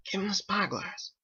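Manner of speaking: lightly voiced sailors, hushed. Sailors spying on the town, hushed.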